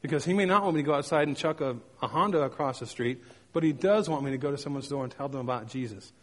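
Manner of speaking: ordinary talk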